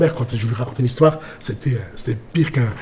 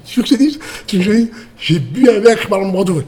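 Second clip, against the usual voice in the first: high, eager retold boast; bursting to share the news; excited announcement; 7 semitones higher, 3 dB louder than usual. Man waking his girlfriend with the Brando news, excited.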